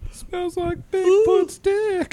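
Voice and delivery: silly voice. Acted. Joke voice, big pitch jump.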